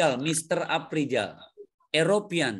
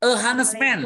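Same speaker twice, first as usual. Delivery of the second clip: louder confirming echo; loud model answer; 9 semitones higher, 7 dB louder than usual. Shown as a demonstration. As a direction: ring out the answer to the class. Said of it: "Very good". (diucapkan keras sebagai contoh)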